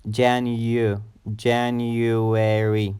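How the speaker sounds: Janu- with a clear "you", then January stretched; slow January, second syllable "you"; YU sound. Shown as a demonstration. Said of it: "it has a U sound".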